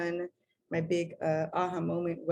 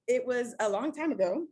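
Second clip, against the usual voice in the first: ordinary talk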